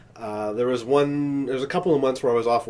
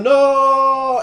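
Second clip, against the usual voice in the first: higher, louder anguished no; mock no; 12 semitones higher, 8 dB louder than usual. Acting Vader's recut scene, loud mock no. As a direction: cry out a hammed-up no. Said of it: "the mask stifles the no".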